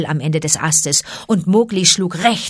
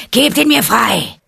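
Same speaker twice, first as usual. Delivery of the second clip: tiger's demand; 5 semitones higher, 5 dB louder than usual. A narrator's reading. Tiger demands the man-cub.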